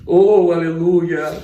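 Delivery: slow, loud drawn-out hallelujah; praise exclamation. Real, not acted. Preacher praising God while telling his testimony, slow loud cry.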